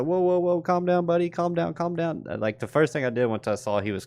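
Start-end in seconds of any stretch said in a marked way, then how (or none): 0.0-2.2 put-on much higher, urgent hushing to a panicked kid; calming son. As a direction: soothe a thrashing child fast. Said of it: "I was like".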